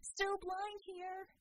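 high and slow, wry complaint; Scope's voice. Acted. Scope reminding Bella she cannot see, high and slow.

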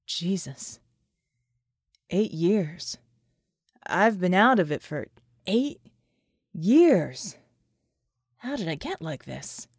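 soldier's stunned inner voice; shaken inner thoughts. Acted. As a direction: think it to yourself in shock.

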